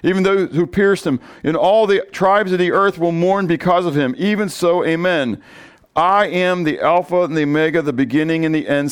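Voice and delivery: scripture reading. Acted. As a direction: proclaim the verses to the congregation. Reads scripture aloud.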